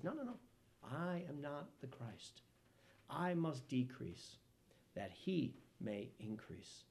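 low, soft, slow humble refusal; lowering himself, gently; humble quiet. Retold or imitated. John the Baptist tells the crowd he is not the Christ, soft and humble.

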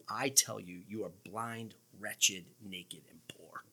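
low, hushed pronouncement, fading away; grave verdict. Acted. Preacher quotes Jesus rebuking the church, low and grave. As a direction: drop low and soft, sinking to almost nothing.

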